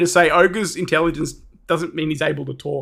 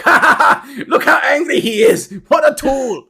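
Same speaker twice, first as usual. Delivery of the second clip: high, loud goblin cackle; mocking goblins; 6 semitones higher, 3 dB louder than usual. Goblins laugh at the angry ogre, mocking. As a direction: jeer gleefully in a high goblin voice. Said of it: "They're like".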